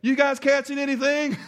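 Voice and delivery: called out high and loud from the shore; Jesus shouting across the water to the fishermen; retold friendly yell. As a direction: hail the boat from far off, teasing. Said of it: "he yells at him".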